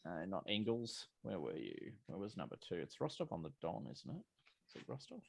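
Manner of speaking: mumbled aside while checking notes; quiet muttering. Real, not acted.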